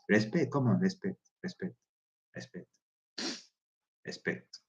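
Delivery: dwindling chant of "respect", trailing into a whisper; fading to a whisper. Real, not acted. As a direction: let each "respect" die away until it's only breath. Urging respect, fading away.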